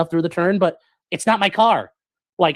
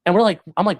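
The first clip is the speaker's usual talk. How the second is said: normal talking voice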